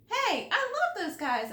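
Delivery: put-on high, bright-eyed child's delight. A narrator's reading. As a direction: squeal over a found treat. Child delighted finding candy.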